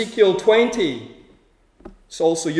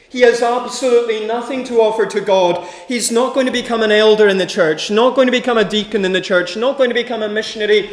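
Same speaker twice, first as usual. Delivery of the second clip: rising, faster preaching build; 4 semitones higher, 6 dB louder than usual. Acted. Preacher driving his point home to the congregation, building. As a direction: pile up each line faster toward the climax.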